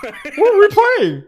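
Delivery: high laughing question. Real, not acted. Asking his friend while laughing. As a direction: ask it mid-laugh.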